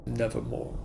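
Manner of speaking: low, hushed raven voice; a deep quiet croak, like the bird itself; raven's croak. A narrator's reading. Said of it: "Quoth the raven".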